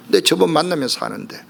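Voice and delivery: ordinary talk, nothing marked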